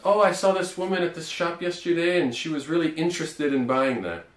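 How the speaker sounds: casual shop story; example sentence. Shown as a demonstration.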